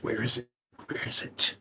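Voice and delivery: quiet muttered ogre searching; muttering to himself. Acted. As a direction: mutter it softly, half-voiced, to yourself. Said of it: "muttering to himself".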